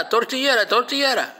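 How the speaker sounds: high, insistent exclamation; imitated warning cry. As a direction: mimic her excited warning. Re-voicing her mistaken shark warning.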